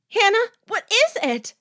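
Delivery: high, very loud urgent question; alarmed shout. A narrator's reading. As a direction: call out in alarm. Alarmed roommate calls out.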